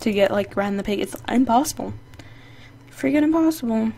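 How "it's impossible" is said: ordinary talk, nothing marked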